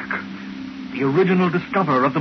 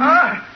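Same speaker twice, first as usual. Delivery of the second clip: sudden high gasp of alarm; startled cry; 10 semitones higher, 4 dB louder than usual. Acted. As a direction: jolt out a frightened "ah". [name] startled by Simon's outburst.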